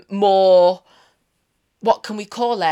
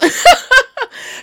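high giggle; 8 dB louder than usual. Real, not acted. Laughs at own joke.

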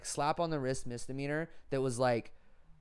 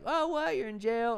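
high, shocked auntie voice; surprised auntie; 10 semitones higher, 4 dB louder than usual. Retold or imitated. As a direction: gasp it out like a startled aunt. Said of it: "She was like".

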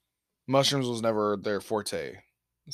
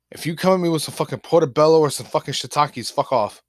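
loud retold rebuke; 5 semitones higher, 8 dB louder than usual. Retold refusal, shouting.

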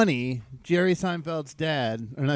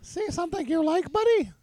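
high squeaky cartoon voice; silly high mock tough-guy squeak; Mickey Mouse voice; 12 semitones higher than usual. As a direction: push the pitch way up like a cartoon mouse. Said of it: "That's kinda like Mickey Mouse".

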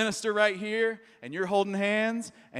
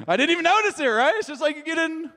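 ordinary delivery

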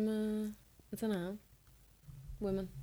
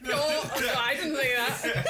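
laughing denial; 6 semitones higher, 4 dB louder than usual. Real, not acted. Denying it to teasing friends, laughing protest. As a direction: protest while cracking up.

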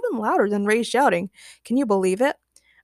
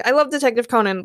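ordinary delivery

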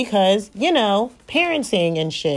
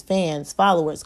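ordinary talk, nothing marked

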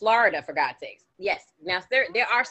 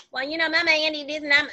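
a light imitation of a put-on Southern accent, a touch louder and higher; mock Southern accent; 8 semitones higher, 4 dB louder, faster than usual. Mocking an East Coaster who fakes a Southern accent, lightly voiced. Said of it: "trying to give me that".

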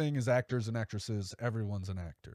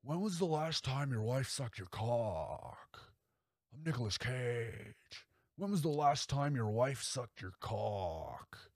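leaning in slow with a softer menacing drawl; Nicolas Cage impression; 4 dB quieter, slower than usual. Serial killer Cage needling the young guest, slow and creepy. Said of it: "He hits him with the".

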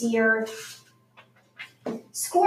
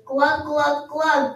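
gurgling glug; much slower than usual. A narrator's reading. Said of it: "gurgled Hedgehog".

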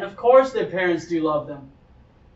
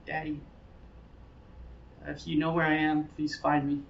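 soft, low, timid little call; mock whisper; 4 semitones lower, 11 dB quieter than usual. Lost boy timidly calling for his daddy, mock whisper. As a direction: barely call out, hushed and tentative. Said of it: "Is he gonna start whispering?".

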